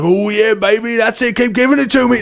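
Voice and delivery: high, excited mock British driver; hammed-up British cheering behind the wheel; terrible British accent. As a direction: whoop it up in a bad British accent. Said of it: "My terrible British accent".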